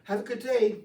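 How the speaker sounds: high, loud, friendly hello; acted chirpy well-wisher; cheery greeting. Cheerful greeter to a surly person.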